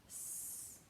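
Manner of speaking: a long unvoiced hiss on S; letter sound s. Shown as a demonstration. Teacher to kids, hissing the S sound. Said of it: "It makes a sound".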